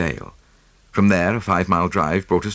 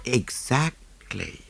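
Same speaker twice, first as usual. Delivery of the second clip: soft, slow and calming; soothing; 5 semitones higher, 6 dB quieter, much slower than usual. Acted. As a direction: settle the flustered man down. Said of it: "said Jap soothingly".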